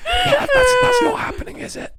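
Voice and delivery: very high, loud squeal through laughter; helpless laughter. Real, not acted. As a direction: squeak it out while cracking up. Cracking up over the guest pitch.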